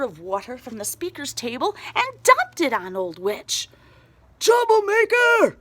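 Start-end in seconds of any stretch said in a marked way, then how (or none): 4.4-5.6 high, loud furious yell; shrill outraged bellow; angry shout. A narrator's reading. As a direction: roar it at the witch. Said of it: "Mister Butterbean shouted".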